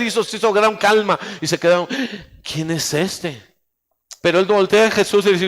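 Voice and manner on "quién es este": hushed, slow and low; stunned murmur, much slower and softer; awed question. Acted. Disciples' stunned question acted.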